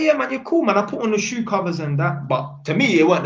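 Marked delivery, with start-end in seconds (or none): 0.0-0.8 high, louder easy acceptance; friendly reassurance. Retold or imitated. Tenant agreeing to shoe covers, reassuring. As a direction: brush it off high and lively. Retelling his reassurance to the dad.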